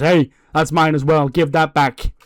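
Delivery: loud, acted call for the belt back; indignant demand. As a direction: call out sharply to reclaim what's yours. Champion demands his belt back.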